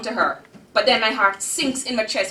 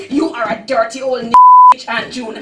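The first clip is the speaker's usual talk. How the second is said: loud, higher rage hurled at the aunt; furious cursing; 7 semitones higher, 12 dB louder than usual. Acted. Girl rages at Aunt June in her head, loud curses. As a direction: spit out every curse you can find.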